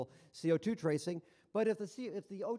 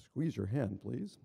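low-pitched machine request; polite first prompt; 8 semitones lower, slower than usual. Retold or imitated. Machine first asks patient to squeeze, low polite prompt. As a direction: imitate a calm device's first prompt. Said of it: "initially it says".

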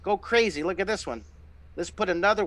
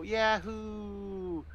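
cheerful yahoo; 3 semitones higher, 7 dB quieter than usual. Shown as a demonstration. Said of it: "take your finger and go".

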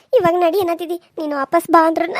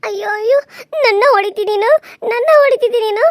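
sobbing cry that rises louder; crying out hurt; 4 semitones higher, 4 dB louder than usual. Acted. Wife wails at her husband after he hits her, sobbing. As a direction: wail that he hit you.

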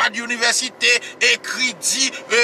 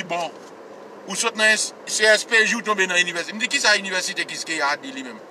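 ordinary delivery

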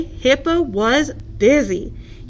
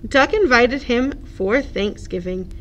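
usual speaking voice

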